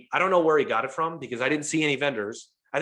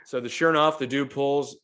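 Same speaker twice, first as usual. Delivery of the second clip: normal talking voice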